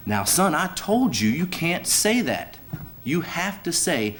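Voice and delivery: a hint of a stern preacher correcting his boy; preacher scolding. Preacher gently scolding his son on the street, mock stern. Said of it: "And the preacher says".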